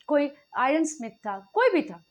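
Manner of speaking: ordinary delivery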